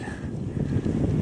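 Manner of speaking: a near-silent exhale of defeat; just breathe out, worn down; breathy sigh. Real, not acted. Cyclist fretting over the time, breathy sigh.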